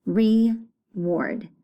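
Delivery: "reward" in two separate beats, re... ward; split syllables. Shown as a demonstration. Teacher clapping out syllables for pupils, "reward" split in two. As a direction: pause clearly after re in "reward". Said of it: "Two syllables".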